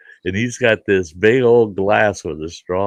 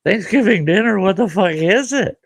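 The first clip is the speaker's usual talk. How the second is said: much higher, louder outraged disbelief; shocked disbelief; 13 semitones higher, 4 dB louder than usual. Retold or imitated. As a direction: squeal it in total shock. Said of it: "I go".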